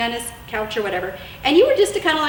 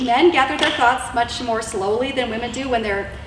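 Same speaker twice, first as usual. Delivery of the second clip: usual speaking voice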